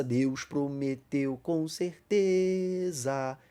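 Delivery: very slow, drawn-out reading of the hymn verse; slow hymn line. Shown as a demonstration. Teacher quoting a hymn verse to the class, slowly drawn out. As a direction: stretch each word of the hymn line. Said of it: "Esse é um verso".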